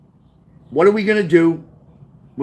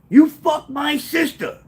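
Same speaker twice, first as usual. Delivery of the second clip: high, slow accusing cry; drawn-out, high-pitched mock yell; retold yelling; 9 semitones higher, slower than usual. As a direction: re-enact yelling an accusation while charging. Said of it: "I start yelling".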